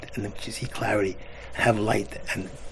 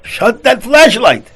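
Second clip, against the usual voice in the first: very loud, high-pitched shout; angry yell; 12 semitones higher, 14 dB louder, slower than usual. Retold or imitated. Moviegoer five rows ahead scolding the flashlight man, yelling. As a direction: yell it across a crowded theater. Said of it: "screaming and yelling at him".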